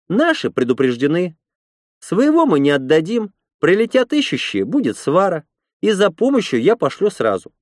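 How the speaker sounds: high-pitched, firm woman's voice; red-haired woman, resolute. A narrator's reading. Red-haired woman answers the warning, resolute high voice. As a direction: argue back in a high character voice.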